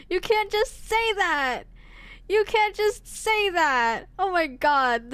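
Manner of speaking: high, slow, drawn-out protest; scandalized outburst. Real, not acted. Streamer reacting to a character's flirty line, scandalized. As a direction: cry out in exaggerated outrage.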